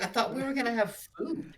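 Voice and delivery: high, fast whiny complaint. Retold or imitated. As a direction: whine it like a hungry guest. Steve complains, no food served.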